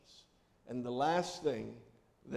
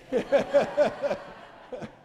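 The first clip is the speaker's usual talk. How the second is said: hearty laugh; 11 semitones higher, 8 dB louder than usual. Real, not acted. Laughing at own joke.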